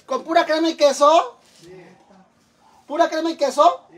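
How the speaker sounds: high, loud outraged question; indignant disbelief. Real, not acted. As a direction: ask it appalled. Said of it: "indignant at the food".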